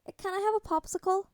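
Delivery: high, sniffly little plea. Acted. Teary boy begs Tony for a popsicle, high sniffly plea. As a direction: plead like a teary kid. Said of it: "he sniffed".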